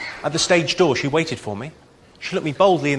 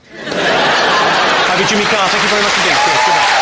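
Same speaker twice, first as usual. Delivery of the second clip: loud sign-off; 4 semitones higher, 6 dB louder than usual. Real, not acted.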